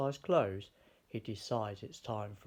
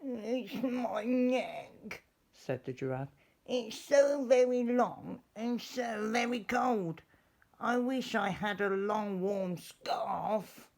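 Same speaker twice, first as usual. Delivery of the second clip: a much higher voice, drooping with misery; sad giraffe; 10 semitones higher than usual. Acted. Giraffe with a cold neck telling George her trouble, high and sad. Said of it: "a giraffe who was sniffing, but sadly".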